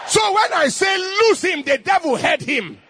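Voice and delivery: fiery preacher shout; full-force preaching. Acted. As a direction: proclaim it to the whole church.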